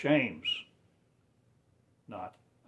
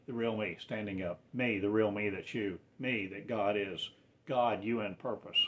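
ordinary talk, nothing marked

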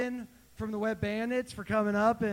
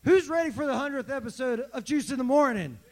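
higher and louder, pushing the crowd; louder repeat; 5 semitones higher, 5 dB louder than usual. Shown as a demonstration. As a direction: call it out again with more energy. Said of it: "I said".

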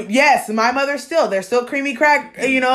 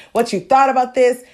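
usual speaking voice

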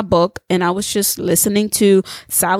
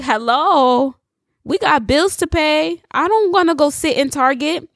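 higher, exasperated hello; sarcastic whining about the bills; mock-exasperated protest; throwing a fit; 6 semitones higher than usual. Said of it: "I put a fit with God".

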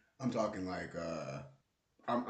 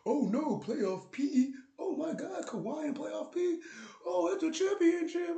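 mock fan hype; 5 dB louder than usual. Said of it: "and you was like".

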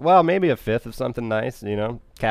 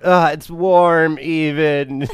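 slow, high, louder whiny complaint; mock complaint; 5 semitones higher, 6 dB louder, much slower than usual. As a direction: stretch it out, higher and louder. Quoting character complaint.

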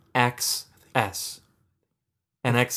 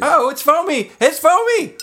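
high, loud startled cry; surprised squeal; 14 semitones higher, 6 dB louder than usual. Real, not acted. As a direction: yelp as the foam rises. Beer foaming over suddenly.

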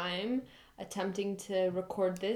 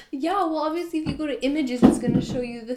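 louder, exasperated; 4 semitones higher, 10 dB louder, faster than usual. Real, not acted. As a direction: push back loud. Heated playful argument.

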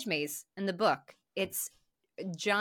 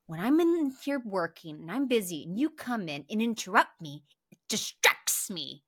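condescending husband; 2 semitones higher than usual. Retold or imitated. Husband scolding his wife for interrupting, coldly patronizing. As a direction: explain it coldly like to a child. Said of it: "he's like".

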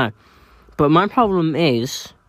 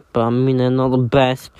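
ordinary delivery

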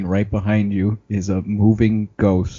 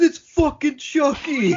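much higher, slow amazed outburst; excited exclamation; slower than usual. Retold or imitated. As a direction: drag it out like a thrilled fan. Gamers spotting Chucky in movie.

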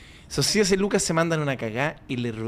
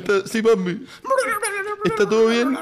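teary laughing sob; 8 semitones higher than usual. Acted. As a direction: choke back half-laughing tears on the phone. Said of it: "¿Por qué estás llorando?".

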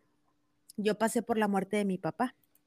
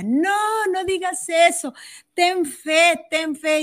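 voicing a tearful friend, raised and higher; pleading friend; 7 semitones higher, 6 dB louder, slower than usual. Retelling a weeping friend begging the mother to keep faith, raised pleading. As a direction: plead with her to have faith, voice lifted.

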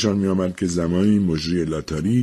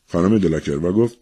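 ordinary delivery

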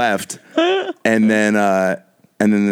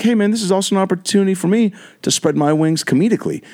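higher, showy stage voice; higher mock-diva voice addressing the crowd; 9 semitones higher than usual. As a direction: play the diva announcing her comedy ambitions. [name]'s voice in a story.

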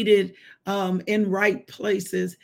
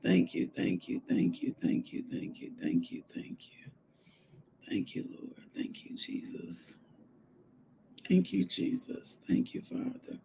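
hushed, breath-only thanks to God; soft whispered praise; whispered prayer; 5 semitones higher, 11 dB quieter than usual. Real, not acted. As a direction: murmur thanks under your breath, over and over.

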